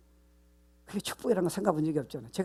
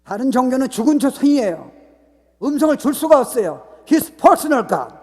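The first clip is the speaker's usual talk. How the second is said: high shouted proclamation, rising to a peak; full-force preaching; 8 semitones higher, 14 dB louder, slower than usual. Real, not acted. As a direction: proclaim it at the top of your voice. Proclaims God is alive. (목청껏 외치는 힘찬 선포)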